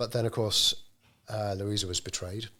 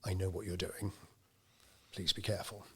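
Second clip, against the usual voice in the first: softer, confiding warning; on the quiet; 7 dB quieter than usual. Retold or imitated. Bob quietly warning Louisa in her shop, softer. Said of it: "he said to her on the quiet".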